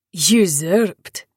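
Usurped with a scornful rising question; scoffing. A narrator's reading. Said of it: "Nikita scoffed".

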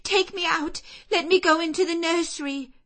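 put-on high, loud, fast child's plea; desperate cry. Jane crying to the servants to free her, frantic. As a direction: beg to be let out. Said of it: "was my cry".

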